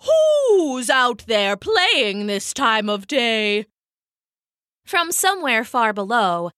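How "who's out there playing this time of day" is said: slow, drawn-out owl yell. Acted. Blind owl yells down at unseen singer, slow yell. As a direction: yell down from the tree. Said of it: "she yelled instead".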